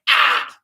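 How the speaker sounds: loud, short acted yelp; hammed-up cry of pain; mock pain cry. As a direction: yelp as if just clawed. Scar's cry when scratched, acted.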